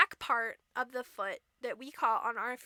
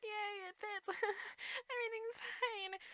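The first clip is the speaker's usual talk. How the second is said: tiny high, soft squeak; feigned cheer through pain; 7 semitones higher, 13 dB quieter than usual. Retold or imitated. Stepsister with severed toe feigns cheer, high and quiet. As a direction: squeak it softly, pretending all is well.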